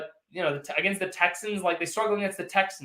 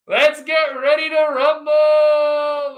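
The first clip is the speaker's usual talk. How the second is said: slow, stretched, much higher and louder ring-announcer call; boxing ring announcer; 8 semitones higher, 11 dB louder, much slower than usual. Pretend. Streamer announcing a super chat fight, booming ring-announcer shout. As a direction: draw out every word like opening a title fight.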